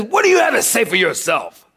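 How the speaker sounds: imitating a drill sergeant's challenge; mock yelled demand. Gunny demanding an answer from the recruit, mock yell. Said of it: "he's just yelling in my face".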